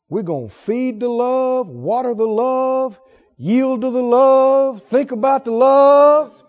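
slow, swelling, love leaned on; rising slow chant on love; building litany. Acted. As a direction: build each phrase slowly.

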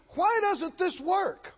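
mildly imitating her exasperated question; frustrated lady. Troubled lady asking the preacher, mild exasperated voicing. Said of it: "She said".

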